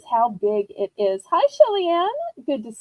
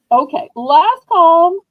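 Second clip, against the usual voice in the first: calling out; 3 semitones higher, 9 dB louder, slower than usual. Acted. Calling out to bidders.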